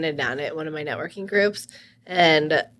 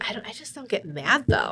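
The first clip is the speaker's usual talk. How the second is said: ordinary talk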